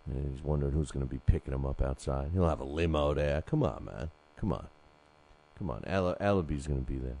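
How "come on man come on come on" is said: usual speaking voice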